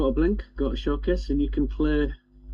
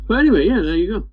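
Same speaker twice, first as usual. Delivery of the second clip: high excited; 9 semitones higher, 7 dB louder, much faster than usual. Real, not acted. Wrapping up demo.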